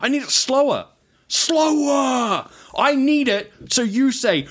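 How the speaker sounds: high, drawn-out demand; baiting a caller, stretching the words; mock insistence.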